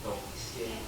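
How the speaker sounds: very low, barely audible murmur; hushed, deep and intimate; whisper in the ear. Acted. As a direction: murmur it secretively, right into the ear. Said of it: "and whisper in my ear".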